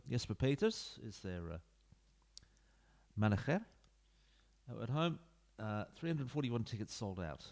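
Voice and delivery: ordinary talk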